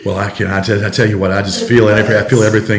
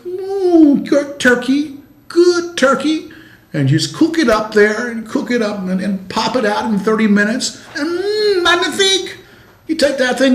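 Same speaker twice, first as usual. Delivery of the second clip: very high, soft cooking-show voice; Julia Child impression; 10 semitones higher, 4 dB quieter than usual. Host as Julia Child praising the turkey, high and soft. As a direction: coo it high like a TV chef. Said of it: "Julia Childs said".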